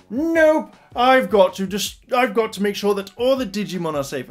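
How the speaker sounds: high, loud "Nope" then shouted insistence; stubborn shout. Retold or imitated. Matt insisting he must keep the Digimon safe, stubborn shout. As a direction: dig your heels in and shout.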